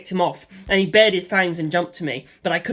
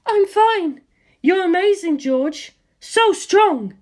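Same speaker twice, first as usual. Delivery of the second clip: much higher woman's voice, adoring; high female voice, admiring; 13 semitones higher, 4 dB louder, much slower than usual. Acted. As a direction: gush over George's strength. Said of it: "Lisa Lisa had never said anything like that".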